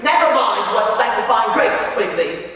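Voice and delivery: brisk, dismissive teacher's rebuke; stern schoolmaster. Acted. Master puts down Question Quigley, stern and dismissive.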